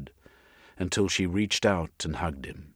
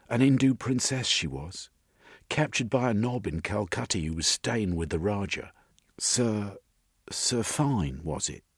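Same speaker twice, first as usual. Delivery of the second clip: higher, lilting storytelling woman; chatty woman character; 4 semitones higher than usual. Acted. Missus telling Jeremy about her mother, higher lilting voice. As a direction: play her recalling family history, pitched up.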